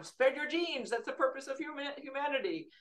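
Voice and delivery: high, lilting mock slogan; mock proclamation. Host quizzes guests on evolution's purpose, mock proclamation.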